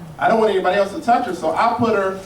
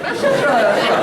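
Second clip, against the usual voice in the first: annoyed retort; 6 semitones higher than usual. Acted. Retort to clueless stranger.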